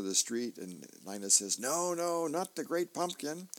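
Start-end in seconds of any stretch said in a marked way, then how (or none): 1.6-3.5 put-on Linus's kid voice. Linus correcting Lucy.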